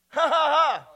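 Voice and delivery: deliberate, shouted "ha, ha, ha"; loud triumphant laugh. Real, not acted. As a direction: belt out a victory laugh. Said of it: "I have a lot to laugh about".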